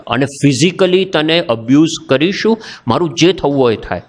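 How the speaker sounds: ordinary delivery, nothing marked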